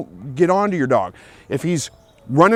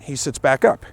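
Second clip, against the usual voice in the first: ordinary delivery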